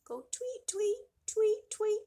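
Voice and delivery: high chirpy tweets; high bird call inviting kids. Acted. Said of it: "Can you make a bird".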